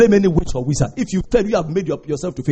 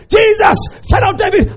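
high shouted plea; beggar's shouted plea; shouting; 10 semitones higher, 4 dB louder than usual. Retold or imitated. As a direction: call out loud for mercy. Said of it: "he shouted".

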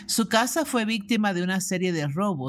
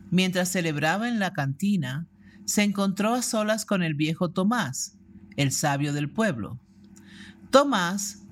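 ordinary delivery

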